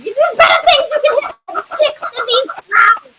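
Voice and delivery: very high, scolding cry; exasperated shout. Acted. Council member scolds the guards, exasperated shout.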